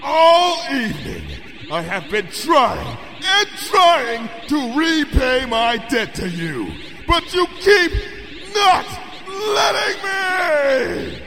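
high, slow, echoing angry shout; seething roar. A narrator's reading. Princess rages that her debt goes unpaid, booming furious shout. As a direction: thunder out every word in frustration. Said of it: "Luna seethed at me".

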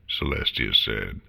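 ordinary delivery, nothing marked

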